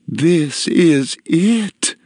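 high, very slow rapture; lilting, drawn-out wonder; melodious cry. A narrator's reading. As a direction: sing out each word in delight. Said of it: "her voice a melodious tune".

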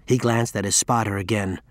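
ordinary delivery

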